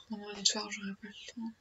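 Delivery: breathy whisper to herself; a soft whispered realization; whispered aside. Real, not acted.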